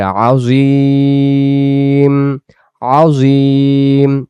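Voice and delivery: very slow "আজিম" with the ee held long; long drawn vowel. Shown as a demonstration. Teacher demonstrating the drawn-out vowel in Azim. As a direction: stretch the ee in "আজিম" far out. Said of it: "একটু টান দিয়ে বলবো".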